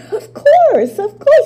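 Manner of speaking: high, loud, laughing reassurance; laughing insistence. Acted. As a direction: laugh it off, too eager to convince. Psyche laughing, insisting she knows.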